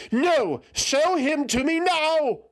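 very high, loud, slow demand; commanding shout. A narrator's reading. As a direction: order it furiously. Eothas refuses, demands.

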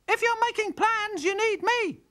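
very high, loud bird screech; jay butting in from a tree, shrieking. Acted. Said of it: "shrieked Jerry the Jay".

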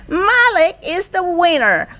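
high, ringing winner announcement; announcing shout. A narrator's reading. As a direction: proclaim the champion in a high storybook call. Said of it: "shouted Charlie".

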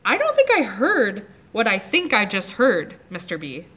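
usual speaking voice

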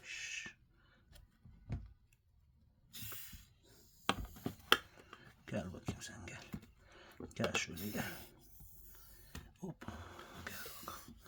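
whispered call to the animal; hushed whisper. Real, not acted. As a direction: softly call it closer. Calling pet in hushed voice.

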